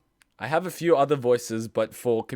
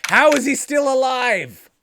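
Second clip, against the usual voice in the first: incredulous; 12 semitones higher, 8 dB louder, slower than usual. Real, not acted. Reacting to Larry surviving.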